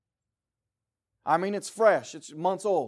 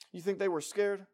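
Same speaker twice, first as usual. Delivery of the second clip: normal talking voice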